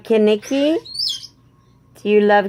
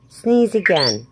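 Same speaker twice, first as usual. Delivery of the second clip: ordinary talk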